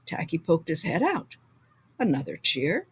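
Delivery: very soft and muffled; hushed. A narrator's reading. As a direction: peek out and ask quietly. Muffled, hidden under sweater.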